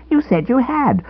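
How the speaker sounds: high, eager schoolboy voice; cheeky boy scout. A narrator's reading. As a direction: play the smug little scout, pleased with himself. Narrator voices Edwin.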